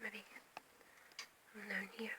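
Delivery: soft unvoiced whisper. Real, not acted. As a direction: hush it while filming. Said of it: "whispering while filming".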